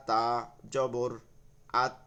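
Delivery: slow spelling aloud. Shown as a demonstration. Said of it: "হরফ বলবো আগে".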